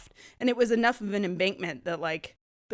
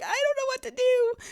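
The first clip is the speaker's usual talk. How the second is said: high, frantic squeal of panic; panicked cry; 3 dB louder than usual. Retold or imitated. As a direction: blurt it out in sudden terror as the brakes fail. Panicked quote while brakes fail.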